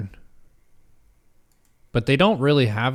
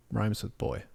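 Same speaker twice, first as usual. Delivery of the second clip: ordinary talk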